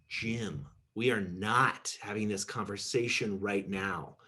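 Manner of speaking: ordinary talk, nothing marked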